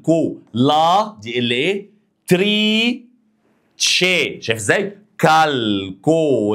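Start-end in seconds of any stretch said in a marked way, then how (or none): 2.3-4.4 "تري" and "تشي" sung out high; teacher's syllable call; syllable drill. Shown as a demonstration. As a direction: pitch each syllable up for repeating. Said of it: "يلا اهو نقولها كده".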